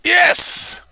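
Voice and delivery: high joyful yell; excited cheer. Real, not acted. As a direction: celebrate the win. Call finally goes through.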